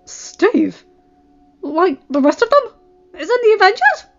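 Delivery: high, loud, incredulous questioning; off-put disbelief. A narrator's reading. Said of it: "Flash was even more off-put".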